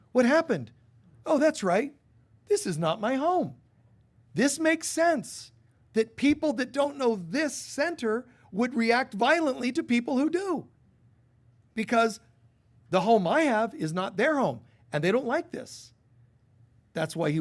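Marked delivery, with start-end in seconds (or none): 0.1-3.6 high, loud dawning surprise; startled, then remembering; voiced as Jesus on the cross; sudden realization. 4.3-15.9 high, loud impassioned preaching; fervent sermon peak; preaching with fervor. Real, not acted.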